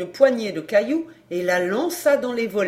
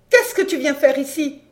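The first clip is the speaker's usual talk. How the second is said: higher, louder challenge; scornful woman; 5 semitones higher, 6 dB louder than usual. A narrator's reading. Woman at window challenging her old partner, scornful. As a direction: demand it sharply, pitched high.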